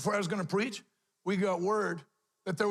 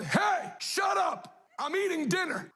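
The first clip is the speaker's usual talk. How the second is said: retold shout; 9 semitones higher, slower than usual. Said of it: "I went".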